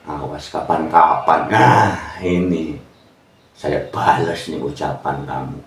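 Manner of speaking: low quiet mock threat under the breath; muttered grudge. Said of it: "ngincim di dalam hati".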